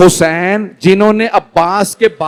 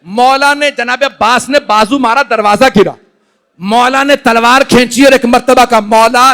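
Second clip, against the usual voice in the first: high, loud impassioned sermon recitation; majlis preaching; 5 semitones higher, 5 dB louder than usual. Acted. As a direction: preach it at full force to the mourners. Majlis preacher narrating martyrdom. (ऊँची आवाज़ में जोशीला बयान)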